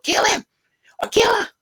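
high, loud mock crowd yell of "Kill him". Retelling how the healed people turned on him, loud mock yell. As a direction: shout "Kill him" up high like an angry mob. Crowd demanding Jesus' death.

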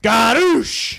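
shouting. Real, not acted. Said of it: "Stop shouting".

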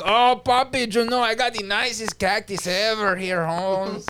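put-on high, drawn-out caricature Mexican accent; hammed-up Mexican-accented homeboy; mock Mexican accent. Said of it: "it's a different accent".

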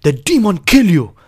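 loud, high, drawn-out mock curse; imitated Caribbean-accented angry outburst; furious snarl. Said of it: "he snarls at me".

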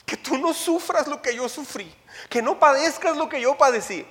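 lightly voiced doting parent, rising plea; a hint of a sentimental father; pleading parent. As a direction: beg like a parent who suffered. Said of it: "parent pleading".

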